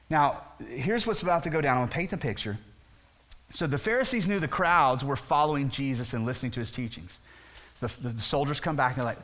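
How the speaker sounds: ordinary delivery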